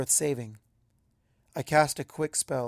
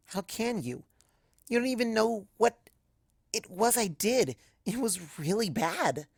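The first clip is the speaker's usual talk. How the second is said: confused; 7 semitones higher than usual. Acted. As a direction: climb higher in disbelief, slowing down. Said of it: "I say, confused".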